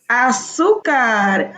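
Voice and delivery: Azúcar very slow, long accented ZÚ; stress on the U. Shown as a demonstration. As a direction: stretch out Azúcar, leaning on the U. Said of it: "Azúcar con acento en la U". (Azúcar muy lento, marcando la ú)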